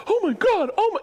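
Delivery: very high mock gasping amazement; imitated amazed boy. Boy overwhelmed by Santa's greeting, squeaky mock amazement. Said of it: "And he'd be like".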